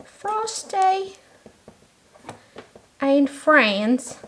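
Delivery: Frosty pitched high and drawn out, and Friends after it; playful sing-song Frosty and Friends; sing-song name. Shown as a demonstration. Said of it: "let's write that down".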